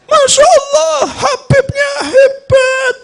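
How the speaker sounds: very high, slow mock sobbing; hammed-up weeping admiration; mock wailing. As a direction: wail it like an overcome worshipper. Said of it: "Satu masjid nangis".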